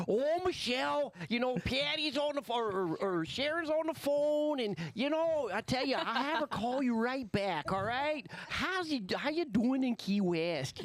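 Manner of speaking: put-on high, slow, singsong northern accent; imitating receptionist's northern accent. Husband mimicking the Montana receptionist: high, slow northern accent. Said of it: "she's like".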